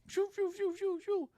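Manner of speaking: very high repeated whooshes; spinning nunchucks. Retold or imitated. Guard starts spinning the nunchucks, imitated whooshing. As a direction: imitate nunchucks whirling through the air. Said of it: "he starts spinning him".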